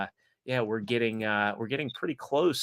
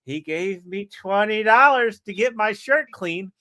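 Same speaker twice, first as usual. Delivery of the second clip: husband's excuse; 7 semitones higher, 7 dB louder than usual. Retold or imitated. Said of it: "She goes".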